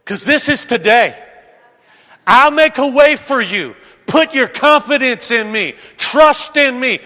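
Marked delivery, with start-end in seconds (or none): 2.3-7.0 loud, swaggering promises of a self-made god; proud king's hollow promises, voice raised; boastful king. Acted. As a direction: sell it like a tyrant who thinks he's god. Voicing the king's promises again.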